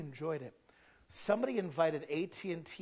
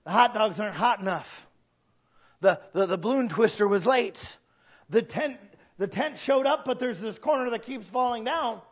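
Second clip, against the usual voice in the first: raised, fretful complaining; lightly acted fluster; mock freak-out; 5 semitones higher, 7 dB louder than usual. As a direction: imitate people fussing over every problem. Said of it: "they're freaking out".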